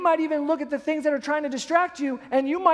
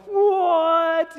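higher, drawn-out mock astonishment; freaked-out jaguar; 5 semitones higher, 4 dB louder than usual. Jaguar facing a roaring cub, freaked-out disbelief. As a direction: act startled and taken aback. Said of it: "like, all freaking out".

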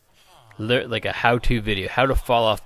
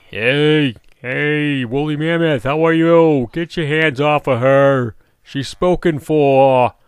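high, loud, slow put-on voice; mock protective shout; 4 semitones higher, 8 dB louder, slower than usual. Warning a mob off his golem, loud high put-on voice. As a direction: bark it like a jealous bodyguard.

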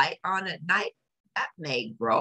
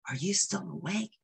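whispered; 4 semitones higher, 7 dB quieter than usual. A narrator's reading. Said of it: "Betty Lou whispered".

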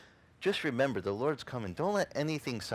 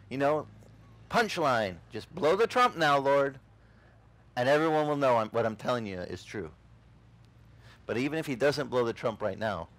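ordinary delivery

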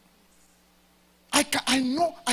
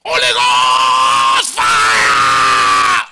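long, drawn-out roaring cry of fire; fiery shout; 11 semitones lower, 18 dB louder, much slower than usual. Shown as a demonstration. Pastor roaring to the congregation, long fiery shout. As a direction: bellow it like a revival preacher. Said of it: "I am not on fire".

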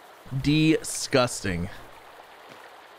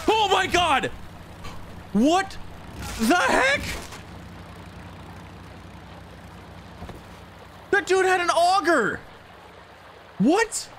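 shocked; 3 dB quieter than usual. Real, not acted. Shocked by enemy in game.

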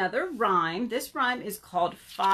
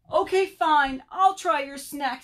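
high, raised-voice giving in; grudging surrender; 7 semitones higher, 6 dB louder than usual. Acted. Hungry Bird gives in to his friends, grudging. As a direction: concede with exasperation.

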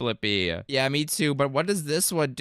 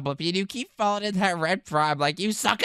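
ordinary talk, nothing marked

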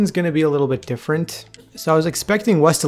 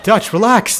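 urgent plea; 8 semitones higher, 5 dB louder than usual. Real, not acted. Repeated plea to companion.

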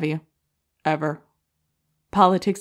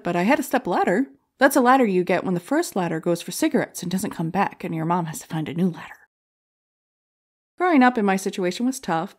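ordinary delivery

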